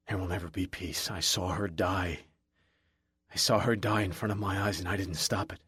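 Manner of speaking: quiet grief. A narrator's reading. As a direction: let the guilt sink the voice. Confessing trauma, broken.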